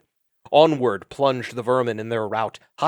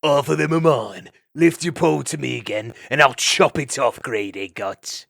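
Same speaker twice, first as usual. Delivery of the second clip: snarling weasel; 2 semitones higher, 6 dB louder than usual. Acted. Burned weasel threatening the ferret, a loud snarl. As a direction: spit it at him like a cornered weasel. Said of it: "snarling at his tormentor".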